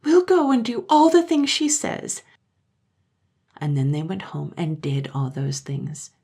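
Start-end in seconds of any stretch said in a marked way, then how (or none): 0.0-2.2 put-on high, loud child voice in despair; high pleading child voice; despairing children. A narrator's reading. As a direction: cry it out as desperate little children. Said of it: "the children cried in despair".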